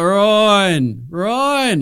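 calling a name out high and loud; drunken yelling. Retold or imitated. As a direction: yell the name across the room. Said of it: "yelling out my name".

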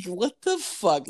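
slow, high-pitched mock astonishment; drawn-out, incredulous retold reaction; retold disbelief. Retells own stunned reaction.

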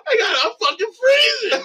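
the dog yelling at the cold; freezing dog cry. Pretend. Voicing cold dog, shouting.

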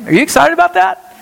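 high, fast, rousing question to the crowd; excited question. Real, not acted. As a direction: pump them up, ask it high and quick. Pastor rousing the congregation.

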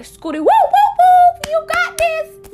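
high, loud whooping cheer; loud hype-friend holler, way up high; hype person. Acted. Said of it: "I am always your hype person".

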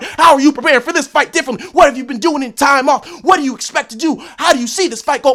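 high, loud mock reporter questions. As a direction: lightly parody a bored reporter.

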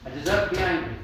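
hammed-up self-righteous claim; indignant. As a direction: play the wounded party demanding their anger. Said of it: "Justice does say".

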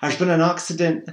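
loud, high, urgent telling; urgent news. A narrator's reading. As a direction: blurt out the bad news. Neighbour bringing urgent news.

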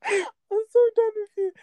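high laughing exasperation; giggling, high done-with-you. Real, not acted. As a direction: squeal it out through laughter. Laughing at sister.

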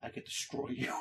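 mock-menacing whisper; hushed threat. Little turtle threatens after demanding a kiss, hushed menace. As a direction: drop suddenly to a voiceless threat.

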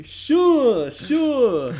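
high, loud, drawn-out "sure"; catchphrase impression. Friend demonstrates his intro tease, in impression voice. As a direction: mimic it high, stretched and loud. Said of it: "the impression so well".

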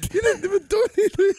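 playfully outraged at the cousin; incredulous. Retold or imitated. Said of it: "looking at you like".

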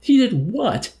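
loud, very high-pitched astonishment; exclaimed disbelief. A narrator's reading. Officer hearing the chaplain's account, loud disbelief. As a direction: exclaim it, voice shooting right up. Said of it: "exclaimed the officer in disbelief".

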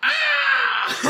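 high shrill bird scream; creature scream. Pretend. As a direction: shriek like a startled beast. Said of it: "screaming at that new sound now".

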